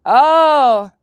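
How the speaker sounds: loud sudden oh; surprised oh. Real, not acted. Spotting the cipher key, a loud sudden oh. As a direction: blurt it as it clicks.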